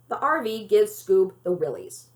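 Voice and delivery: plain reading voice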